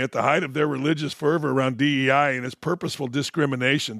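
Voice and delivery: ordinary delivery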